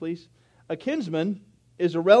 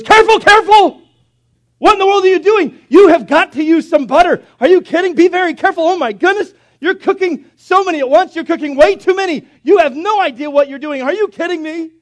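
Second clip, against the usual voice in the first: high, loud panicked yelling at his wife; frantic husband shouting; 11 semitones higher, 10 dB louder than usual. Retold or imitated. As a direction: go bananas over the frying eggs. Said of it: "He just went bananas".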